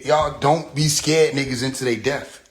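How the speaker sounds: normal talking voice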